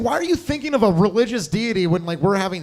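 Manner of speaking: ordinary talk, nothing marked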